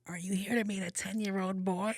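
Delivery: put-on high accusing old lady voice. Old lady repeating the accusation, high.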